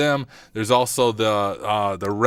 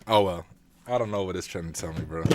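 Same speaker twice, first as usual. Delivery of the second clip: ordinary delivery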